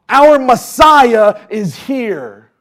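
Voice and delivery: slow, loud triumphant proclamation; proclaiming shout. Acted. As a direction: declare it like the believing crowd. Preaching climax, crowd's belief.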